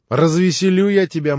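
high, loud teasing promise; playful. Acted. Grushenka, perched on Alyosha's lap, playfully teasing him. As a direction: tease him into cheer.

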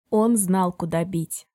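ordinary delivery, nothing marked

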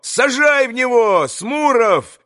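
high-pitched, drawn-out rallying cry; excited shouting. A narrator's reading. Boys urge Smurov to throw at the enemy, excited shouting. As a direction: egg them on in a high, slow shout. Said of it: "закричали все".

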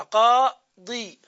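قاضي drawn out very slowly and loud; slow demonstration. Shown as a demonstration. Teacher sounding out قاضي with its kasra. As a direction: stretch the final i of قاضي. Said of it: "كسرة".

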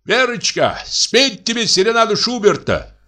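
a lightly played husband calling his wife; tipsy husband. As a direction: call up merrily to the window.

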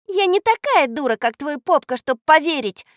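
high, loud, cross comeback; angry retort. A narrator's reading. Girl refuses to believe the boy, angry retort. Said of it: "сердито огрызнулась Катя".

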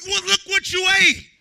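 loud, rising sermon climax; full-force preaching. Real, not acted. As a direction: preach it out to the whole church.

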